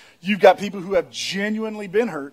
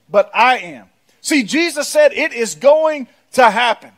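emphatic, raised pulpit voice; forceful preaching; 6 semitones higher, 5 dB louder than usual. Acted. Preacher warning church that trouble will come, forceful. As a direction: hammer each warning home with stressed words.

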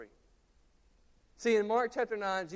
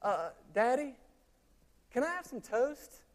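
put-on a light little-boy voice, higher; child asking; 6 semitones higher than usual. Four-year-old son interrupts his father to ask for toast, lightly voiced child. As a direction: pipe up like a small boy wanting toast. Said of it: "Jude says".

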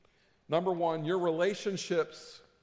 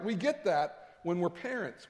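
ordinary delivery

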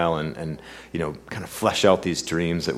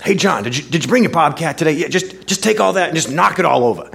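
put-on higher, fast Brooklyn accent; [name]'s Brooklyn accent; 10 semitones higher, 6 dB louder than usual. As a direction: egg John on in a Brooklyn accent. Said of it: "come out with this great Brooklyn accent".

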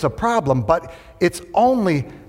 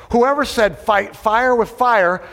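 emphatic preaching; 7 semitones higher, slower than usual. Acted. Preacher mocking a saying.

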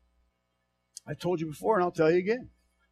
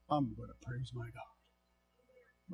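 much softer, slow, low vow; hushed vow; 9 semitones lower, 20 dB quieter, slower than usual. Real, not acted. Preacher to church, hushed determined vow. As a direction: say it under your breath. Quiet resolve after rant.

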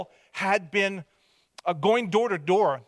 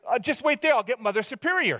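higher-pitched nun impression; nun's voice; 7 semitones higher than usual. Nun asking boys to wait for Mother Superior, high nun voice. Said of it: "and she said".

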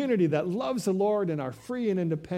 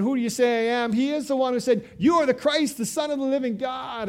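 excited declaration; 6 semitones higher, 6 dB louder, faster than usual. Retold or imitated. Preacher voices Peter's confession.

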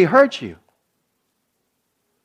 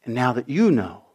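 soft, low and tender, almost hushed; gentle confession; 4 semitones lower, 7 dB quieter than usual. Retold or imitated. Parent softly telling grown child about old hurt. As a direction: ease the child into forgiving, gently.

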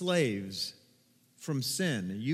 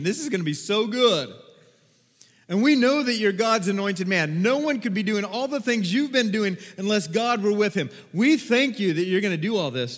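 rising, louder, joyful crowd acclaim; excited crowd praise; 4 semitones higher, 5 dB louder than usual. Acted. Crowd hailing Jesus, voiced excitedly by the preacher. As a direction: cheer him on as the longed-for king.

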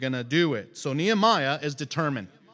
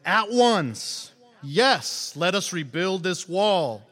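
raised, louder rallying reply; eager agreement; 3 semitones higher, 4 dB louder, slower than usual. Acted. The people all replying to Nehemiah, eager and emphatic. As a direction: answer the call with vigour.

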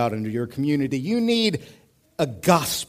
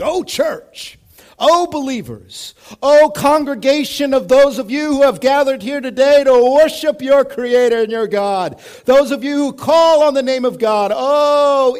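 much higher, louder, grand preaching cry; mock preacher call; 11 semitones higher, 11 dB louder than usual. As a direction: orate it like an old-time revival preacher. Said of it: "that's church language".

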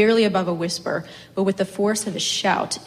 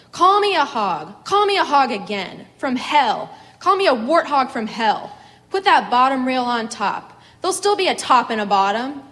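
loud, higher, defiant shouting; furious yelling; 5 semitones higher, 7 dB louder than usual. A narrator's reading. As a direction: shout the challenge over the fields. She keeps yelling across pasture.

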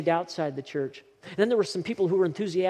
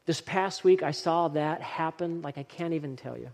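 ordinary talk

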